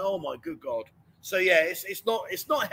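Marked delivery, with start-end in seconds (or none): 0.0-0.8 lower, softer exasperation; exasperated groan. Retold or imitated. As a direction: sigh it out, fed up. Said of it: "I'm like".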